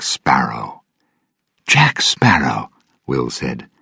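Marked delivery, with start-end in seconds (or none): 1.7-2.7 breathless whisper of surprise; hushed, amazed whisper. Acted. Captive recognises his old friend.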